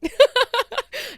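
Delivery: loud, high cackle; burst of laughter. Real, not acted. Speaker joking about her late nights, loud laugh. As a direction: crack up at your own joke.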